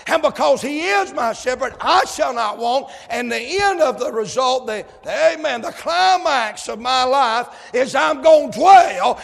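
high, loud preaching to a shouted climax; preaching at full force. Acted. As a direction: drive it home to the church.

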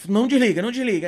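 high, insistent retold plea; urgent. Friend guiding him by phone, urgent. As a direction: urge him to stay on the line.

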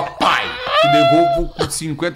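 high, slow mocking voice of the friend; imitating friend. Mimicking the broke friend promising to repay, high mock voice. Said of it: "E ó".

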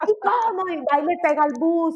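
high, indignant cry; mock indignant challenge, pitched high; indignant outburst. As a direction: fire up, ready to chase the bus. Impulsive type reacting to bus.

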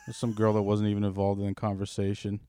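ordinary delivery

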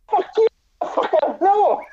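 high, hammed-up angry snap at a friend; mock-furious brush-off, laughing at the end; angry retort. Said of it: "He was getting so mad at me".

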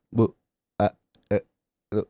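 words cut off mid-syllable; bad connection. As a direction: fake a breaking phone line. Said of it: "Suaranya putus-putus".

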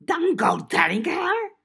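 high loud goblin screech. Acted. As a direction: screech it in alarm. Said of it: "Gashwad screeched".

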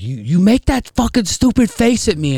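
high, mocking yelled quote; mock angry yell at son. Dad mocking his son's stupid face, high mock scold. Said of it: "I was like".

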